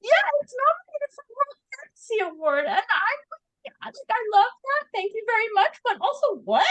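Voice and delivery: high-pitched, amazed disbelief; real shock. Real, not acted. Author on her award nomination, genuinely shocked. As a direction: marvel at it, pitch way up. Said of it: "I'm so shocked".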